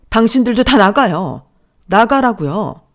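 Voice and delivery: yelling them out of the house; wife shouting at the in-laws to leave; furious shout. Acted. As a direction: throw them all out, full voice. Wife throws everyone out.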